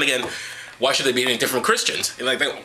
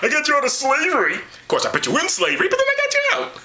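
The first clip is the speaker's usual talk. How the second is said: mock God voice; 11 semitones higher than usual. Voicing God boasting.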